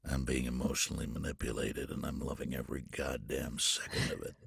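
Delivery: low, soft gravel-free grumble of delight; low dry aside. Retold or imitated. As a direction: lean in and confess it low. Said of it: "he leans across to me and goes".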